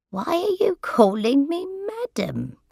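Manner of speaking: rising, puzzled question; a touch of bewilderment. A narrator's reading. Old lady puzzled by carer, mild surprise. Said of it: "with a puzzled expression".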